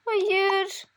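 high, terrified begging; frightened pleading. Acted. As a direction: plead for mercy, high and afraid. Character pleads with an intruder.

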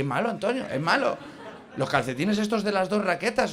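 ordinary talk, nothing marked

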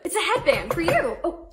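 ordinary talk, nothing marked

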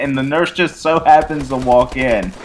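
ordinary delivery

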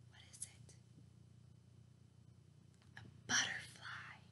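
soft secret whisper. A narrator's reading. Reading to kids, a hushed whisper. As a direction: hush it, barely voiced.

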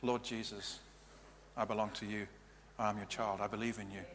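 quiet, gentle prayer of dedication; hushed model prayer; soft prayer voice. Shown as a demonstration. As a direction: pray it softly, as the person would. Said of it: "So what's a prayer of dedication?".

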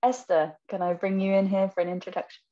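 ordinary talk, nothing marked

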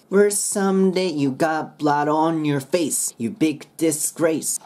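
slow rhythmic "someday" straight into "you got blood"; run-on lines. Shown as a demonstration. Teacher showing how parts connect, slow rhythmic linking. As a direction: chain "blood on your face" without a break. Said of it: "すぐ移ってください".

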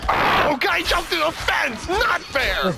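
high, loud protest; outraged yelling. Real, not acted. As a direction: yell that it's unfair. Reacting to enemy in game.

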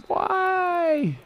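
very high, drawn-out wail; despairing cry at a bad throw; anguished why. Real, not acted. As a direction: plead with the player in disbelief.

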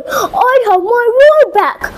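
high, exultant lion's cry; happy roaring cry. Acted. Lion has his voice back, happy high cry. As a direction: call it out high, with joy. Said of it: "Leo let out a happy roar".

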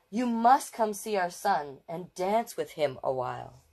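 plain reading voice

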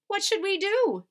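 high, loud worried plea; Missus Watson. Acted. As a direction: ask in acted panic. Alarmed wife asking husband.

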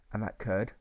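ordinary delivery, nothing marked

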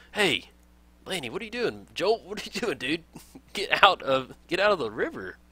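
high-pitched, incredulous scolding of game characters; exasperated, high-pitched call. Real, not acted. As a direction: call them away, voice jumping high. Calling out to dwarves in game.

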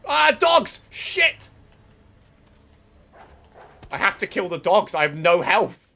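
loud high panicked yelps; panicking gamer. Real, not acted. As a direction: panic as dogs attack. Dogs attack with no health.

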